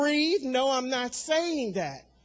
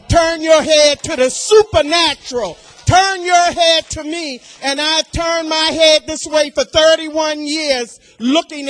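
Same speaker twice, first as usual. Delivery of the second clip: full-force preaching; 4 semitones higher, 10 dB louder than usual. Real, not acted.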